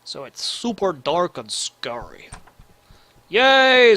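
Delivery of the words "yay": higher, louder little cheer; gleeful cheer for spooky night time; excited cheer. Real, not acted. Cheering at the dark.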